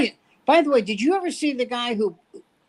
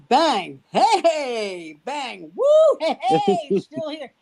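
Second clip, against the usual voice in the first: acted-out gunshot bit; 7 semitones higher, much slower than usual. Shown as a demonstration. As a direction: jump higher with each bang, laughing. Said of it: "I go like this".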